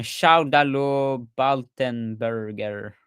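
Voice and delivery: put-on name read slowly in a half-started French accent. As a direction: start the name with a French lilt, then let it slip. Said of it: "I started in a French accent and I didn't".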